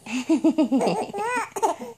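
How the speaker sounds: quiet breathy chuckle; soft laugh. Real, not acted. Mother laughing.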